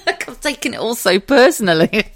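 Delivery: high, loud, through real laughter; laughing. Real, not acted. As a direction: laugh at yourself as you admit it. Laughing at herself.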